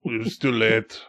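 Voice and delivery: weak, fading put-on voice; sweaty, sick friend. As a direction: trail off softly, utterly defeated. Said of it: "he's sweaty and just like".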